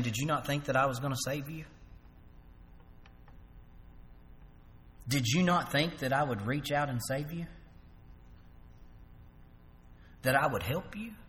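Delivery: soft, gentle reassurance; reassuring. Retold or imitated. Jesus reassuring the sinking Peter, soft and gentle. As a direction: comfort him quietly.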